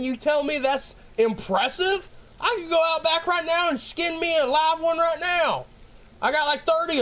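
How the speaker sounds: high-pitched, worked-up comic outrage; indignant boast. Real, not acted. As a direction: scoff and brag at full tilt. Indignant boast disputing Boone's fame.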